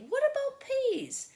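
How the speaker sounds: high, loud coaxing question; big brother voicing a question; big brother asking. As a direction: coax the little sister. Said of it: "I say".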